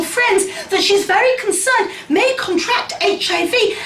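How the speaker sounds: high-pitched, put-on earnest concern; mock publicist spin. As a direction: parody an official statement, pitched well up. Mimics publicist's spin on quotes.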